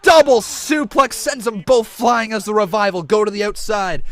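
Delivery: high, very loud wrestling call; excited shouted play-by-play. Real, not acted. Commentator calling a flying top-rope attack live, excited shouting. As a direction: belt out each move as it lands.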